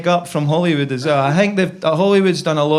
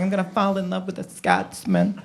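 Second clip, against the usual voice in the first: soft imitation of an American girlfriend; lightly mocked American sweetheart accent; 6 dB quieter than usual. Excited girlfriend on first coming here, mock American accent. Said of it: "my girlfriend's American".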